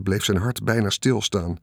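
ordinary delivery

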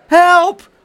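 high, very loud cry; panicked scream. A narrator's reading. Aunt seeing her child fall, high loud scream. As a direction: scream for help. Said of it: "schreeuwde tante Pleeg".

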